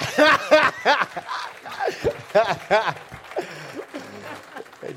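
high, loud laughter; long delighted laugh. Real, not acted. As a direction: burst out laughing. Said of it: "laughing after impression".

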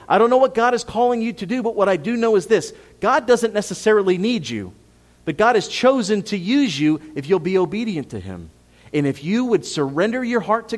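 fervent preaching. Acted. Pastor calling the church to obey, preaching at full force. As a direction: rouse the congregation from the pulpit.

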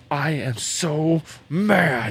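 slow, drawn-out mock angry kid; retold tearful anger. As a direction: stretch every word like a fuming boy, acted out. Retold upset boy crying at football.

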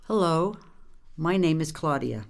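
ordinary delivery, nothing marked

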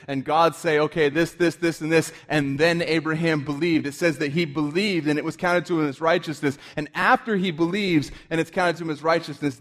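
high, loud, fervent preaching; impassioned preacher voice; full-force preaching. Acted. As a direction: preach it out to the congregation, building.

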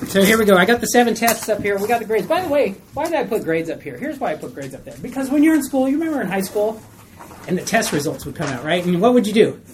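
normal talking voice

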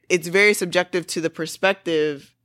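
normal talking voice

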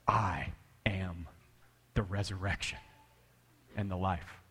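low, whispered I am; Jesus speaking softly to a grieving friend, low and hushed. Acted. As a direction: breathe it out slowly and low.

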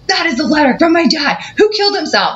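very high, strained angry outburst; outraged cry. Retold or imitated. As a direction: let it burst out, shaking with anger. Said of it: "and I go".

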